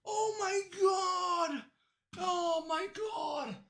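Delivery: high, slow ecstatic screams; overjoyed shouting at the verdict; shrieking with joy. Real, not acted. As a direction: scream it out in disbelief and delight. Reacting to death sentence verdict.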